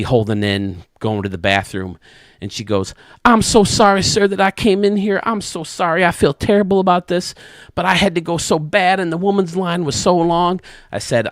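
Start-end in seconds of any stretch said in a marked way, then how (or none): 3.2-10.6 higher, pleading apology in a put-on lady voice; a flustered older lady saying sorry from the next stall; apologetic woman's voice. Old lady apologizing to the man in the next stall, higher and pleading.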